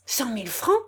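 incredulous question with a rising end; stunned, rising echo; astonished cry. A narrator's reading. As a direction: exclaim the sum in disbelief. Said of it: "s'écria Janille".

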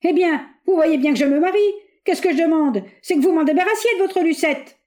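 high, loud exasperated shout; outshouting. A narrator's reading. Bois d'Anguin outshouts the General to explain himself, exasperated. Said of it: "criant plus fort que lui".

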